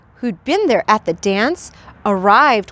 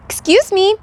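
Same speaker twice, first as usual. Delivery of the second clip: small cricket voice; 8 semitones higher than usual. Acted. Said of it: "coughed a cricket".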